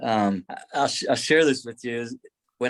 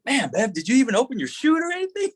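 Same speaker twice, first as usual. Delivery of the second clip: teasing buddies; 11 semitones higher than usual. Retold or imitated. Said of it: "they're like".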